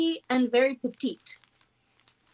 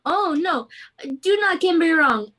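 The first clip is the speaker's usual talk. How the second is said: put-on protesting captain in a hammy French accent; bad French accent; 2 semitones higher, 4 dB louder than usual. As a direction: hurry to correct them, vaguely French. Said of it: "the worst French accent to ever exist".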